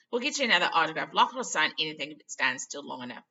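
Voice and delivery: loud and fast. Acted. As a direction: hurry her along. Retake louder faster.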